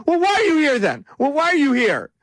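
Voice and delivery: mock indignant teen, high and loud; teenager tantrum. Host mocking a candidate confronting a reporter, teenage tantrum. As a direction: imitate a petulant teen's high-pitched outburst. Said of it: "acting like a teenager".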